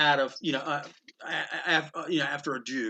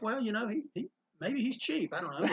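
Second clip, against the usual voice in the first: inner thoughts; 6 semitones higher, 8 dB quieter than usual. Retold or imitated. Private thought while drinking.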